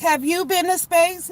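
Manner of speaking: high, slow prissy mock question; mock average person. Mocking a woman who asks if we've been to space. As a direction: sing it up high like a smug lady asking. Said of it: "she's like".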